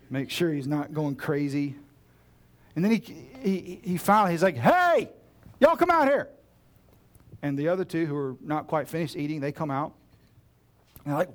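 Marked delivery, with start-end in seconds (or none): 4.6-6.3 high, loud call to friends outside. Retold or imitated. Magus calling his friends out onto the balcony, loud and high. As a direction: shout across the house to come look.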